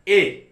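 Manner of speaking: ए said loud as a full ay; strong ए, loud and clear; strong form a. Shown as a demonstration. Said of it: "क्या बोलूंगा".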